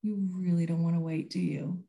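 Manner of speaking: quiet, gentle reassurance; mother gently soothing her impatient son, quietly; soft calm to son. Retold or imitated. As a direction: speak softly to calm an upset boy. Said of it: "And I just said".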